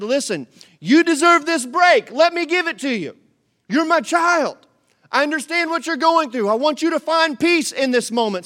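high, loud, pleading call as God; loud, high heavenly plea; God's voice, pleading. Acted. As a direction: cry out God's invitation, full of love. Preacher voicing God's loving invitation.

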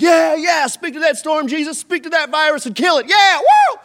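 loud, high hype; cheering shout. Retold or imitated. Churchgoers cheering Jesus on, hyped shout. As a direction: cheer him on like a fan. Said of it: "we're like".

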